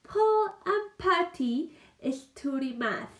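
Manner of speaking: "Paul and Patty study math" read slowly and clearly; slow model sentence. Shown as a demonstration. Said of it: "Veamos la siguiente oración".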